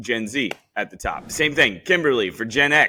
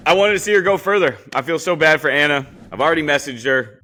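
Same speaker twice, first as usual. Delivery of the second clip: upset outburst; 4 semitones higher, 6 dB louder, faster than usual. Real, not acted. Upset about Anna's elimination.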